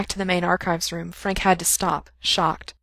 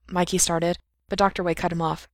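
ordinary delivery, nothing marked

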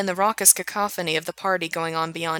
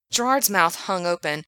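plain reading voice

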